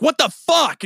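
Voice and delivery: sudden high shocked yell; startled yell. Retold or imitated. Yelling at a dropped paper, startled shout. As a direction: blurt it out in alarm. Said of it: "and I was like".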